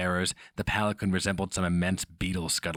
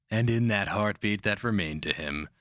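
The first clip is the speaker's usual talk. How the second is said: ordinary delivery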